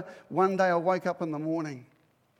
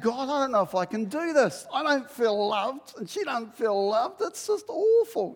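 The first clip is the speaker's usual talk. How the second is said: despairing prayer; 6 semitones higher, 5 dB louder than usual. Retold or imitated. Husband praying in despair, lightly voiced. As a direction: plead it weakly to God. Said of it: "And I remember saying".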